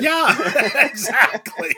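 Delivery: high laughing yeah. Real, not acted. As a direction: laugh through the words. Laughing along.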